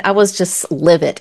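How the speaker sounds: usual speaking voice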